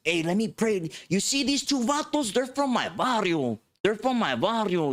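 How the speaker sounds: high, proud pulpit voice; imitating preacher. Preacher presents the two vatos from his barrio, high proud pulpit voice. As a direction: show them off to the church.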